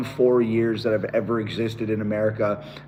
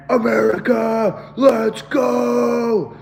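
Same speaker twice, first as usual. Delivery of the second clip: imitating his scream; 10 semitones higher, much slower than usual. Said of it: "I was screaming so much".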